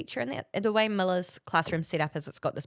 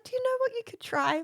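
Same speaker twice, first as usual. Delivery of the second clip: very high coaxing question; patronising teacher's bright idea, pitched way up; imitated helpful teacher. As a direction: suggest it in a sugary high voice. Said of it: "And she was like".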